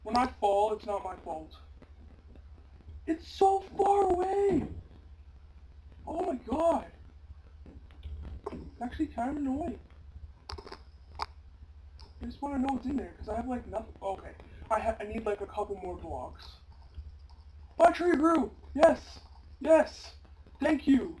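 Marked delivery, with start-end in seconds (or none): 3.1-6.9 high, loud and drawn out, slowed; loud slow whiny complaint; exasperated cry. Real, not acted. Exasperated cry at distant island. 17.8-21.1 loud, high triumphant yells; real loud joyful cheering; excited cheer. Real, not acted. Cheering success in game.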